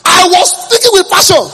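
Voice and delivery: high, loud laughing exclaim; preacher mocking his own passion; excited exclaim. Real, not acted. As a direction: proclaim it with amused gusto.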